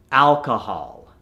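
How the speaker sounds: alcohol slowed, ending in open "hahl"; American accent. Shown as a demonstration. Coach gives the converted word, American accent. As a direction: say "alcohol" American, last vowel "ah". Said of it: "to the American accent".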